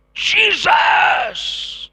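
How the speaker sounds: long, high, piercing cry; wife's shout. Retold or imitated. Preacher re-enacts his wife calling on Jesus, shouted. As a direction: belt it out like a desperate call for help. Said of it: "and she shouted".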